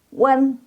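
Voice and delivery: Wen held high from rounded lips. Shown as a demonstration. Teacher has pupils watch the mouth, high held Wen. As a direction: round into Wen and keep it level. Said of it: "liatin mulutnya".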